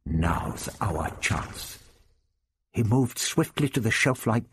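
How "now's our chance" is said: low, slow, hushed warrior; a deep, careful alien voice; low Hrostar voice. Acted. Hrostar speaks in the story.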